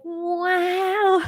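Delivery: put-on a light high child's wow; awed kid. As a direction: gasp it out like a dazzled little kid. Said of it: "little kids, they'll be like".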